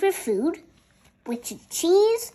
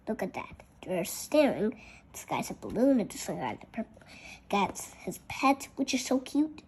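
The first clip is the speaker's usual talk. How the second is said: soft murmured aside about the pictures; hushed side comment to a listener; quiet aside; 5 semitones lower, 9 dB quieter than usual. Real, not acted. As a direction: drop out of the story and chat softly.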